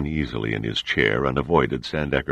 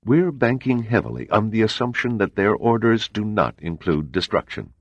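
higher, measured voice of a composed spy chief; cool CIA agent; 8 semitones higher than usual. A narrator's reading. CIA man explains the murder to the admiral, composed. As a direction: lay out the facts coolly and evenly.

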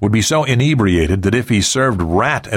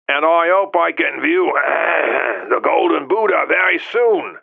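high croaky voice broken by throat-clearing; hacking cough voice; 9 semitones higher than usual. A narrator's reading. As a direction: hack and clear the throat through it. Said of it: "phlegmy coughing fit".

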